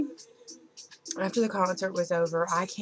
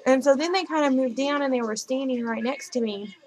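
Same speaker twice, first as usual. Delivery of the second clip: ordinary talk, nothing marked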